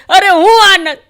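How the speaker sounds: high, loud fed-up complaint; exasperated groom. Retold or imitated. Newlywed groom complains to the comedian, high and exasperated. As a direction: wave it off, fed up.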